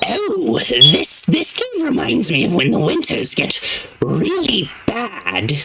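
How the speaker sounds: high, odd voice-modded hermit. Acted. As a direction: play the strange old Hermit. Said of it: "Let's try the Hermit one more time".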